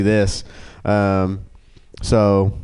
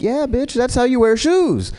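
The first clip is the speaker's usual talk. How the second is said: sarcastic; 13 semitones higher than usual. Retold or imitated. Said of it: "I'm like".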